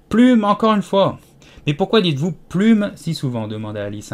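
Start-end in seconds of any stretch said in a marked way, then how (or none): 0.1-1.2 loud, high, changed voice; sheep shouts again. Acted. Sheep calling to Alice once more, loud high shout. 1.7-3.5 a bit annoyed. Acted. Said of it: "un peu contrariée".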